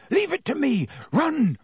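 very high-pitched, strained cry; urgent command. Acted. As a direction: order them away desperately.

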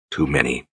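low, soft whisper. A narrator's reading. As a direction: breathe it out quietly. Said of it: "he whispered".

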